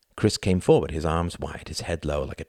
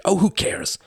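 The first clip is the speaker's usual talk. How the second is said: high, louder hissed outburst; impatient hiss; 5 dB louder than usual. A narrator's reading. Youngest brother dismisses a guest's caution, impatient hiss. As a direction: hiss it, brushing the objection aside. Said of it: "hissed Chris".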